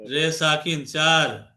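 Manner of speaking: "রে সাকিন চার" drawn out very slowly, part by part; very slow spelling demo. Shown as a demonstration. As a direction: spell "রে সাকিন চার" at a crawl so the student can follow. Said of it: "সব শব্দ শেষে সাকিন হবে".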